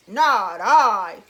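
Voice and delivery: low, loud gruff voice; gruff it out like a big dog. A narrator's reading. Dog refusing to help the hen, low gruff voice. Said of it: "said the dog".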